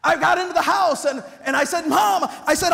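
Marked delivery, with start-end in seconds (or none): 1.9-2.3 loud, high call of the mother; yelling for mom as you burst in; thrilled boy shouting for mom; excited call. Retold or imitated.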